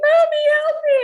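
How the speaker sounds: put-on very high, loud child's cry for help; little girl pleading. Daughter stuck on the toilet begging mommy, high wailing plea. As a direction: wail it like a scared kid. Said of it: "She goes".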